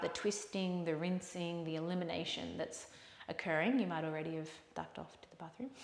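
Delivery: soft, hushed yoga closing voice; quiet, unhurried instruction with long pauses; guided relaxation. Real, not acted. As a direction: guide them softly toward rest. Guides closing breaths and farewell.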